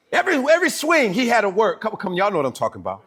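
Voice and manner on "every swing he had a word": ordinary delivery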